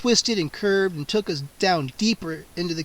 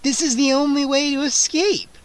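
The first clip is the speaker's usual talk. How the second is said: teasing; 8 semitones higher, 4 dB louder than usual. A narrator's reading. Vampire taunting her prey.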